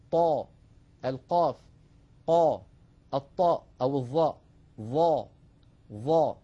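each letter named, then its syllable said loud and heavy; emphatic letters drill. Shown as a demonstration. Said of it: "أنطق وحاولوا أن تنطقوا معي". (تدريب على نطق الحروف المستعلية بتفخيم)

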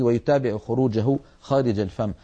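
ordinary talk, nothing marked

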